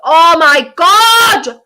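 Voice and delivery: loud drawn-out oh my God; shocked exclamation. Real, not acted. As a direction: gasp it out in disbelief. Shocked by the defending live.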